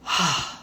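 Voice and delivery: long audible letting-go sigh; big sigh. Shown as a demonstration. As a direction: sigh it all out like a release. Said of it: "like a big sigh".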